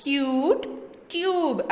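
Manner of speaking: "क्यूट, ट्यूब" with a yoo like the letter's name; long U sound. Shown as a demonstration. Teacher shows students the long U, yoo in "क्यूट, ट्यूब". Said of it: "यू का long vowel sound".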